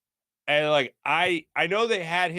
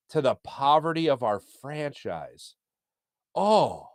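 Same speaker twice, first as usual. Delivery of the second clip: ordinary delivery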